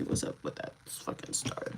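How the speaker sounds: soft unvoiced conspiratorial aside; whisper. Real, not acted. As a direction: whisper it like a secret. Suspicious aside about staff.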